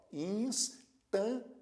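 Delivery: instância drawn out as ins, tân, cia; teacher's slow sounding-out of instância; slow, paused pronunciation. Shown as a demonstration. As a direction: pause between the three syllables of instância. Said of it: "pronuncie pausadamente essa palavra".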